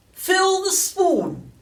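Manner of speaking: very high, loud, drawn-out order; imperious storybook hag, stretched and piercing; commanding old woman. A narrator's reading. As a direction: screech the command slowly as a bossy grandma. Old grandmother giving an order.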